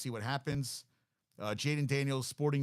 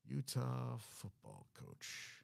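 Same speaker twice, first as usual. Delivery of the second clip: slow, soft, drawn-out syllables; absent-minded muttering; 10 dB quieter, much slower than usual. Real, not acted. Host typing a coach search, muttering slowly to himself. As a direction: talk to yourself as you type.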